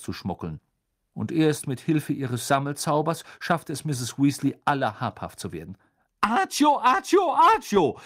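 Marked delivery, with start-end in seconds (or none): 6.2-8.0 high, loud repeated incantation; called-out spell. A narrator's reading. Mother summons sweets from the twins, loud commanding cries. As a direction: fire off the spell in urgent shouts. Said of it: "rief sie".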